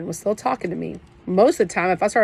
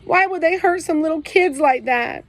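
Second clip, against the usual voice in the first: high, loud voice breaking with sobs; crying; 10 semitones higher, 5 dB louder than usual. A narrator's reading. Kenny asks his brother Byron why, crying high and loud. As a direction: choke it out through tears, pitched up. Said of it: "making a bunch of weird noises".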